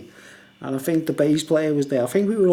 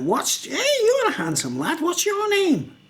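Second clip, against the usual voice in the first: high, sing-song fawning voice of a weird bloke; high fawning mock voice; mimicking creepy guy; 14 semitones higher than usual. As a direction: gush at the lad in a high put-on voice. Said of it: "he went".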